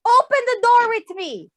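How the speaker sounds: ordinary delivery, nothing marked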